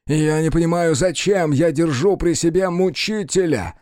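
indignant outburst about a tormentor; angry complaint. Acted. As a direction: complain hotly, voice pushed up. Molière rages about his servant.

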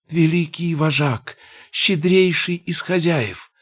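high squeaky goblin voice. Acted. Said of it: "бормотал он".

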